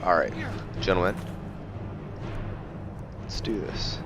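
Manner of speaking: ordinary talk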